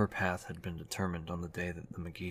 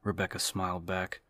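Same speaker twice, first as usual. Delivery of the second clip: ordinary delivery, nothing marked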